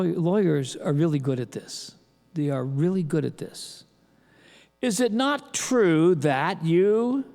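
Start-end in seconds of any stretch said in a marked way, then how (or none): 4.8-7.2 lawyerly leading question. Shown as a demonstration.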